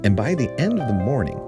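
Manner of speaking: ordinary delivery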